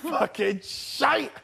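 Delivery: very slow, dragged-out scoff; mocking Jason. Friend voicing Jason scoffing, very slowly. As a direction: drawl the scorn out. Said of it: "He'd come in and be like".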